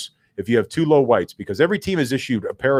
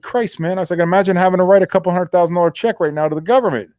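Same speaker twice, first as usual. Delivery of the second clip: griping complaint; 6 semitones higher, 3 dB louder than usual. Retold or imitated.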